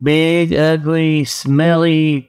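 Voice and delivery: ordinary talk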